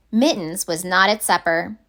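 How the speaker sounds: plain reading voice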